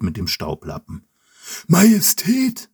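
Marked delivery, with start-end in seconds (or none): 1.7-2.7 high, slow servant greeting; old servant voice. Acted. Old servant greets the king, high and slow. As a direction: announce yourself as the old servant.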